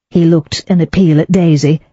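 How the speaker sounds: usual speaking voice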